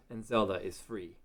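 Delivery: normal talking voice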